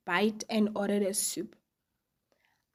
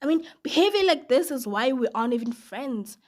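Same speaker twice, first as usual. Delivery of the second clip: plain reading voice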